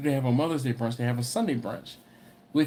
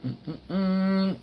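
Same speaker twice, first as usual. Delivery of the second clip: savoring mm; 4 semitones higher than usual. Acted. Savoring escargot taste.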